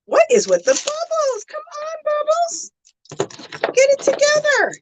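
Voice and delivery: high squeaky mock exasperation; playful scolding. Streamer to bubbles that will not come, mock scolding.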